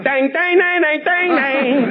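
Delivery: very high sing-song dang repeats; mock Colombian guys. Comedian mimics two Colombian guys in the hall, high sing-song. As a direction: chant dang like a dazed smuggler. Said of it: "two guys from Colombia standing in the hall going".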